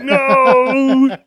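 high, drawn-out comic wail; mock cry of no. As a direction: yell it long and high in mock horror. Acting out a panicked reaction.

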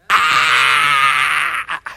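loud, sustained demonstration scream; primal scream. Shown as a demonstration. Preacher demonstrates students' primal scream on campus, full scream. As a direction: scream at full force, one long blast. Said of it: "they have a primal scream".

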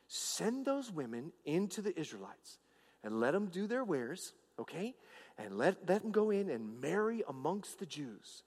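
low, hushed plotting; confiding schemer. Retold or imitated. Balaam advising the king on trapping Israel, soft confidential scheming. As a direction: drop soft and low to lay out the plan.

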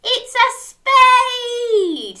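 high, loud, drawn-out reveal; excited reveal. Pretend. Storyteller reveals the answer to children, excited. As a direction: stretch the answer into a cheer.

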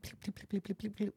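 soft high bleeping noise; imitated bleeps. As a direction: mimic suppressed shots softly. Imitating silenced gunshots.

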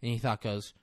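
usual speaking voice

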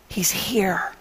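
a hushed whisper of urgent good news; soft whisper, barely voiced. A narrator's reading. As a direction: breathe it like a secret for her sister alone. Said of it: "Martha spoke quietly to her sister".

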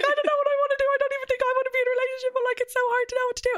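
very high, fast wailing sob voice; hammed-up crying wail; mock crying. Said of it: "I would just start crying".

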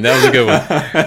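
loud laughing repeat; laughing hard. Real, not acted. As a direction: laugh it out, really cracking up. Laughing at the joke.

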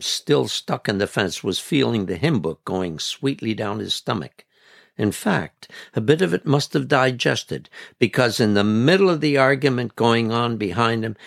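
usual speaking voice

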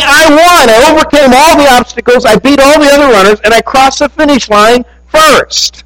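elated winner, pitched high and bright; exultant runner. Retold or imitated. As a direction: crow about winning the race, a bit quick. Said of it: "He was saying".

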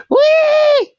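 mock squealing teen girl, very high and loud; teenage girl squeal. Imitating scared teenage girls with a high squealing shout. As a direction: shriek it like a giddy teen at a clown. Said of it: "the teenage girls are".

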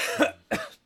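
breathy coughing fit; choking cough. Real, not acted. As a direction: cough out the rest of the water. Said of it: "while I die".

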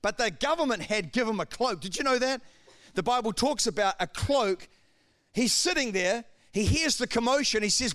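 ordinary talk

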